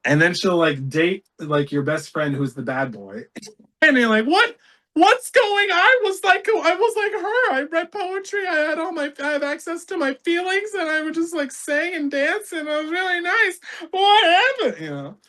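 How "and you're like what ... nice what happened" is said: very high, plaintive mock voice; hammed-up falsetto self-mockery; naive younger self. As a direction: squeak it as a bewildered sensitive boy.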